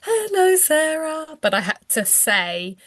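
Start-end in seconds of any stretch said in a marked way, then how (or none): 0.0-1.4 imitating the little girl echoing the greeting; child echoing. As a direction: copy it back like a little girl. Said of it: "she would go".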